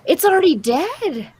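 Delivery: disgusted shock. Real, not acted. Reacting to gross video.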